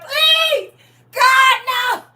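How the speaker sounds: high, loud pleading shout to God; desperate cry for mercy. Retold or imitated. Israelites begging God to spare them, high desperate shout. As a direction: beg God to stop it, crying out.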